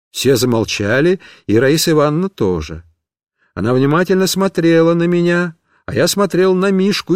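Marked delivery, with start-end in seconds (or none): none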